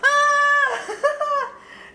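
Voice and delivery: loud high laugh-yelp; shocked laughing shout. Real, not acted. Crushes the pearls by accident.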